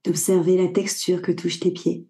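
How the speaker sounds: calm Yoga Nidra voice with long pauses; guided meditation. Acted. Guide leading a listener through body awareness before sleep, guided meditation. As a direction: guide the listener inward in short, unhurried phrases.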